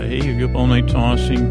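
usual speaking voice

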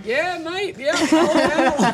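loud, very high Australian with laughter; laughing, hammed-up Aussie agreement; exaggerated Australian. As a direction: lay the Australian on thick, humouring them. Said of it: "You go even more Australian with that".

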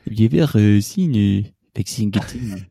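heavy French-Canadian parody; mock Québécois accent. Shown as a demonstration. Co-host taking the accent too far, mock Québécois accent. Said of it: "Elle n'est pas québécoise".

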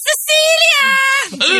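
long high shouted call up the hallway; mom yelling a name. Retold or imitated. Mother calling her daughter from the hall, loud drawn-out yell. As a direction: bellow the name like a mother catching you out. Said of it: "mamma är ofta så här och smäller igen dörren och så bara".

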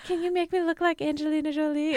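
high hopeful plea. Retold or imitated. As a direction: ask it like an eager teen. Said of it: "I'll be like".

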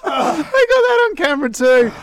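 high, loud laughing words. Real, not acted. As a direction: laugh it out through the heat. Laughing after eating the chili.

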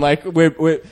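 ordinary talk

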